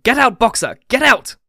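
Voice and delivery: high, loud urgent shouts; frantic shouted warning; crowd's cry. A narrator's reading. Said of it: "took up the cry of".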